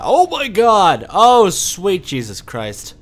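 high, drawn-out, loud outcry; loud shocked exclamation. Real, not acted. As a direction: cry out slowly in disbelief.

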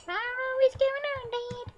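put-on high, small child's voice for the son; son character voice. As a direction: voice the son talking to his dad. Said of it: "I can't do voices".

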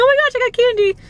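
high, thrilled squeal; excited. Retold or imitated. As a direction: squeal it like finding treasure. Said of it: "I was like".

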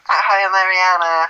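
high, slow, sugary pop-star hello. Pretend. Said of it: "if [name] looked like this".

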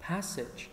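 Passage with stress on PAS; stress first syllable. Shown as a demonstration. Teacher drills first-syllable stress in Passage. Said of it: "âm một".

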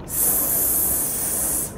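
S as a long voiceless hiss, sss; letter sound S. Shown as a demonstration. Teacher giving kids the first sound of small, a voiceless sss. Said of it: "what sound do you hear at the beginning".

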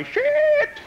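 high-pitched loud shriek; panicked yell. Acted. First Aussie meeting a shark, panicked yell. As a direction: shriek it out in one piercing burst. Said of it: "He didn't know whether to say".

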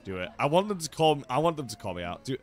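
usual speaking voice

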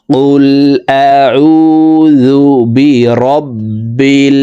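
কুল আউযু বি রব্বিল drawn out, throat ain; slow tajweed model. Shown as a demonstration. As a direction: recite it slowly for students to copy. Recites phrase slowly as model. (ধীরে শুদ্ধ তিলাওয়াত)

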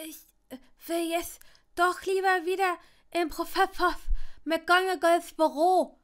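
high, half-stifled wail that swells loud; smothered whining. A narrator's reading. Longbottom squashed into Draco's cloak, whining half smothered. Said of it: "hörte er Longbottom jammern, halb erstickt".

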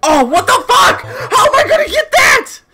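very high, loud panicked ranting; frantic yelling. Real, not acted. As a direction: yell in squealing exasperation at the game. Frantic outburst at the game.

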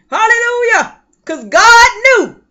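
loud, high, slow preaching cry; full-force preaching. Real, not acted. Woman testifying to the congregation, loud preaching cry. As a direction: lift it up like a preacher at the peak.

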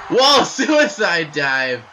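loud, slow, laughing whoa; laughing shout. Real, not acted. Reacting to a dive out.

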